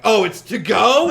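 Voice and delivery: hammed-up indignant question; mock outrage. Sandwich maker hearing it's to go, loud mock outrage.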